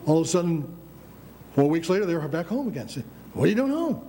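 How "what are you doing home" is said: higher-pitched incredulous question; mock surprise. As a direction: act out asking a surprise returnee. Retold question to early boot camp returnee.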